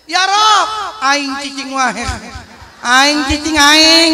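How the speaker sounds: loud, slow, laughing Sundanese drawl; laughing parody of a Sundanese prayer; mock Sundanese prayer. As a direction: ham up a stubborn Sundanese praying. Sundanese man praying, mocked.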